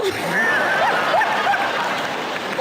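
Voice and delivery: high airy burst of laughter. Real, not acted. Speaker laughs at his Murphy's Law joke, airy high laugh. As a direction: laugh at your own joke.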